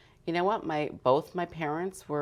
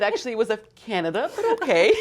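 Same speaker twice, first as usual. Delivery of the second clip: high, loud, laughing retort; 8 semitones higher, 7 dB louder, faster than usual. Retold or imitated. Retelling her reply to the optometrist, laughing loudly. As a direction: laugh through the correction. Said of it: "And I said".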